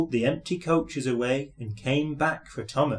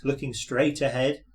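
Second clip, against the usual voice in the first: ordinary delivery, nothing marked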